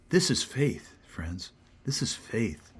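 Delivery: hushed, intimate whisper. Real, not acted. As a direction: breathe it to the congregation. Preacher whispers the point.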